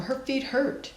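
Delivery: ordinary delivery, nothing marked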